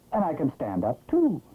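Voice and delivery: ordinary delivery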